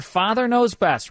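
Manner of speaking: high, slow title announcement; announcer. Acted. Host announcing this hour's show, high and slow.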